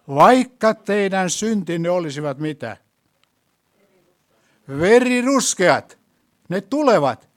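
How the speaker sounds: loud, slowed preacher's verse reading; emphatic scripture reading. Acted. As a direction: proclaim each word of the verse. Reads Isaiah verse forcefully.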